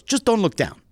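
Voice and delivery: high, loud Greek-accented imitation; heavy Greek accent. Instructor advising his student at the cliff edge, high Greek accent. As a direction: call it out in a mock Greek accent. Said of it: "in a very heavy accent".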